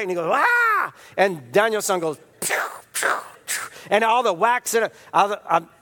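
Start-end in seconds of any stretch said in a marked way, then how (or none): 0.3-0.9 high sharp martial-arts yell; karate shout. Retold or imitated. Miyagi attacking Daniel, a sharp high shout. 2.4-3.7 breathy whispered blocking noises; whispered pew sounds. Retold or imitated. Daniel blocking the punches, whispered pew pew.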